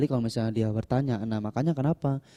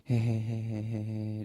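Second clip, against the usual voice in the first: slow, drawn-out mock chuckle; unhurried imitation giggle; imitated chuckle; 4 dB quieter, much slower than usual. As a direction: imitate the monk's drawn-out hehehe. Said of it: "cuma bilang gini".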